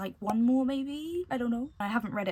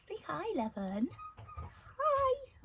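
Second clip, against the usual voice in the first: cooing coaxing; 14 semitones higher, 4 dB quieter, slower than usual. Real, not acted. Vlogger coaxes Levin to say hi, cooing high. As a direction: coax a little one in a squeaky high voice.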